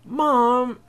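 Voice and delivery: put-on boy whining at his mom. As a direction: whine it like a sulky kid. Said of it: "the boy whined".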